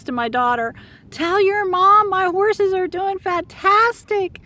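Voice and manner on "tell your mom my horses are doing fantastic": much higher, louder and slow, gushing gratitude; gushing thanks. Retold or imitated. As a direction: play the overjoyed owner, gushing high thanks. Grateful horse owner thanking.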